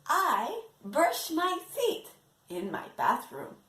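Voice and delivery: ordinary talk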